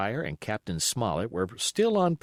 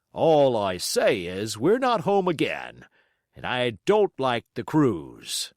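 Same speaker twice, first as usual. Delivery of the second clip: slow, louder grim captain; captain's warning; 5 dB louder than usual. Acted. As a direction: warn them slowly and firmly. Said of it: "he would add".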